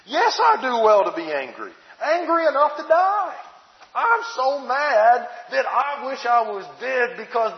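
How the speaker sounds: hammed-up angry sulk, a touch of tantrum; sulky anger. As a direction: Jonah answering God, mildly mock furious. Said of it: "notice his reaction".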